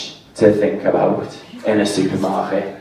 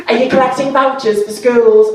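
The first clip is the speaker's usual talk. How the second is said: high, louder cashier voice; mock cashier; 12 semitones higher, 4 dB louder than usual. Mocking the cashier's voucher question in a high voice. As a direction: ape the till woman's chirpy question.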